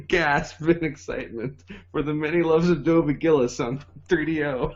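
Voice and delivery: slow promo announcer voice. Acted. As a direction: hype it like a TV ad.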